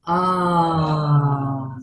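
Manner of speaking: drawn-out exhaling sigh; audible exhale. Shown as a demonstration. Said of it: "softening the jaw and making that audible".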